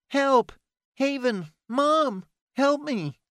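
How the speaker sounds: panicked cries. A narrator's reading. Little brother seized by vampire.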